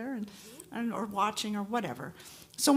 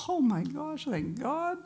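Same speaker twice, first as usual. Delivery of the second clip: relieved Eddie; 8 semitones higher than usual. Retold or imitated. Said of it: "And he's like".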